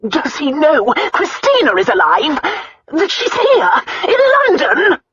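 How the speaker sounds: high, loud outrage rising to a shout; shaking with anger. A narrator's reading. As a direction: demand it furiously, voice climbing. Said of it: "Her voice shook with anger".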